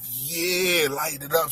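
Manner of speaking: low, soft, easygoing reply; imitating a carefree buddy; laid-back friend. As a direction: drop the voice down low like a chilled-out smoker.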